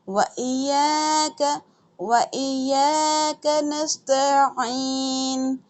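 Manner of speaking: slow chanted नअबुदु व इय्याका नस्तईन; Quran recitation. Shown as a demonstration. Teacher reciting for students, slow and melodic. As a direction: recite the verse slowly and melodically.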